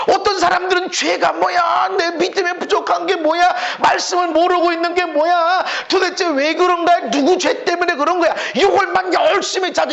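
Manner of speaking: high, insistent accusing questions; hammed-up high-pitched interrogation; mock fault-finding. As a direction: mimic someone hunting for whom to blame. Mimics fault-finders hunting for blame.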